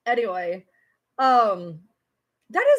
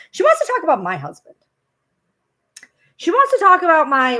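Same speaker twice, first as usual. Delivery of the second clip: indignant; 4 semitones higher, 7 dB louder than usual. Real, not acted. Mocking indignation.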